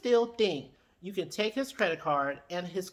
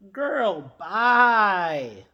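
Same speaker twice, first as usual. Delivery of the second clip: dismissive; 8 dB louder than usual. Real, not acted. Dismissing Destiny.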